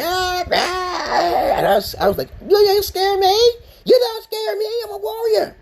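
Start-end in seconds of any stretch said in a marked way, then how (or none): 0.0-1.5 high-pitched wyvern shriek; imitated monster cry. Player mimics the dragon coming down at him, high shriek. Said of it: "He comes down like". 2.4-5.5 very high-pitched hammed-up bravado; mock defiance. Player taunts the attacking dragon, high mock-defiant voice.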